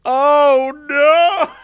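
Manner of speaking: high, loud comic cry; exaggerated dismay. Reacting to the worsening rant, loud hammed-up dismay. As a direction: wail it in mock horror.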